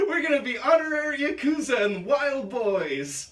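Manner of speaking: loud, high giggling through the words; laughing. Real, not acted. Cracking up at a friend's Knoxville joke, laughing loud.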